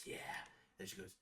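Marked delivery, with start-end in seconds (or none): 0.0-0.1 hushed imitation of a cat lady. She has turned into cat lady, hushed cat voice. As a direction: softly imitate a cat woman. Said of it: "a cat voice after".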